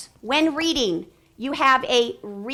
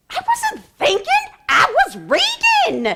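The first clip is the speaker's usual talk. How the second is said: very high, slow, loud mock protest; indignant kid; 8 dB louder, slower than usual. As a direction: mimic a student correcting the teacher. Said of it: "they look at us".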